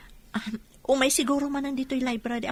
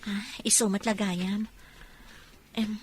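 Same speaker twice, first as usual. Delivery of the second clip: whisper; 6 semitones lower, 5 dB quieter than usual. Acted. Secretive talk in library.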